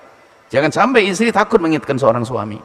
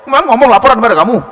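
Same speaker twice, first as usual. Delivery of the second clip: higher, loud and fast snap; irritated retort; 9 semitones higher, 8 dB louder, faster than usual. Acted. Husband snaps at his wife, irritated. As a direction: bark it back, annoyed.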